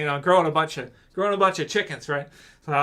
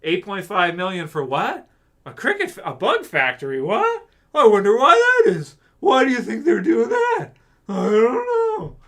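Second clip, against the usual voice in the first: clueless zombie voice; 5 semitones higher, 4 dB louder than usual. Retold or imitated. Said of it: "They're just like".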